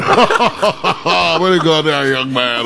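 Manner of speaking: very slow, savoring each word; the teller replaying the dad's praise, slow; drawn-out mock dad. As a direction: stretch it out mockingly. Slowed repeat of dad's line.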